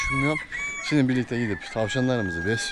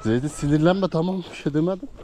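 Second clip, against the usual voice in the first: soothing pet talk; 6 semitones higher than usual. Real, not acted. Soothing angry goat.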